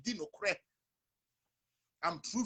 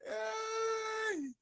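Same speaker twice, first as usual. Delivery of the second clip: high shout. Real, not acted. Exclaims after laughing.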